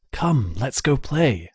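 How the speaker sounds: slow, distant, beckoning voice of the land; luring forest voice. A narrator's reading. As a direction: coax the child in, slowly, like the forest itself. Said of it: "It was calling out to the fool".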